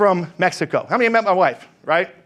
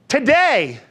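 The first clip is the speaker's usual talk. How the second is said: usual speaking voice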